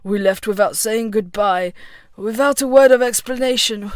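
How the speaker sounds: slow confession thick with regret; understated, heavy-hearted apology; regretful. A narrator's reading. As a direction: let regret weigh down each phrase. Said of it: "his voice thick with regret".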